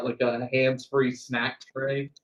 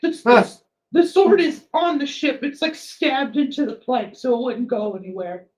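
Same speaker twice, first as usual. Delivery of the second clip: higher, jittery helper voice; a flustered, eager-to-please character; nervous NPC voice; 11 semitones higher than usual. Acted.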